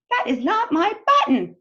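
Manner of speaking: high, loud wail; a hammed-up lament for his lost button. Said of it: "wailed Toad".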